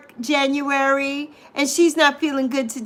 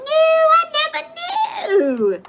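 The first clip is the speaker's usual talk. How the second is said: much higher astonished squeak; amazed; 14 semitones higher than usual. Real, not acted. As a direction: squeak in disbelief. Amazed at fun fact.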